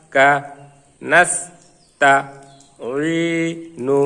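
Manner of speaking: each Arabic syllable alone, with a pause after it; teacher's syllable-by-syllable recitation drill; broken-up syllables. Shown as a demonstration. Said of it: "ভাঙ্গা ভাঙ্গা উচ্চারণ করব". (ভাঙ্গা ভাঙ্গা করে উচ্চারণ)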